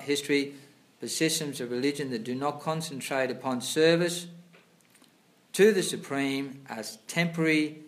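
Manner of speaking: loud, formal reading of scripture; reading aloud. Acted. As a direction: read the passage out like a sermon. Reads scripture purport aloud louder.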